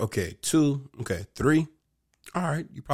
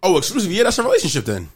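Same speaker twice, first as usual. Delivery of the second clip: sudden realization, loud and high; oh, now it clicks; surprised; 10 semitones higher, 8 dB louder, faster than usual. Real, not acted.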